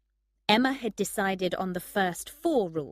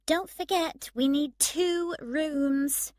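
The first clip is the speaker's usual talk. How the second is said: high, drawn-out mild shout; calling after him; 9 semitones higher, slower than usual. A narrator's reading. Woman to her friend as he sets off, mild shout. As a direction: call out a reminder over a distance. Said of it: "Emma had shouted after him".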